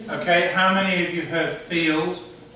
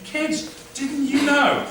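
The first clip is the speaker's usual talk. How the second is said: high, slow teasing teacher voice; sing-song mock surprise at the class; mock teacher to kids; 10 semitones higher, slower than usual. Shown as a demonstration. Teacher teasing pupils, high and slow. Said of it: "you can say".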